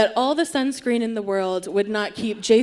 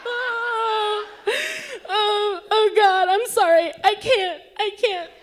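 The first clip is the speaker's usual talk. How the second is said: high, choked crying; overwhelmed mourner crying at the microphone; sobbing; 10 semitones higher, slower than usual. Retold or imitated. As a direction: break down mid-sentence. Sobbing apology at funeral.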